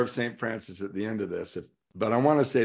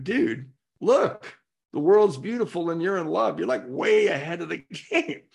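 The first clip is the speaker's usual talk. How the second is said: raised, high-pitched casual telling-off; exasperated God; 6 semitones higher, 4 dB louder than usual. Acted. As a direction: play God impatiently straightening out a pal. Acts out God scolding him.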